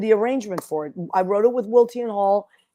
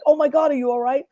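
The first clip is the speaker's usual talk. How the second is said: alarmed concern; 6 semitones higher, 3 dB louder than usual. Retold or imitated. Acts out Leo's alarmed reaction.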